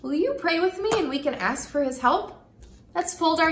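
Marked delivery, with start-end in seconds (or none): none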